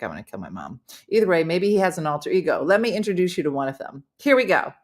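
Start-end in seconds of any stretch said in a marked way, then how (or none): 0.0-0.8 low, soft mock murderous aside; mock threat. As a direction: drop it low and quiet, imitating him. Mimics the guest's joking threat.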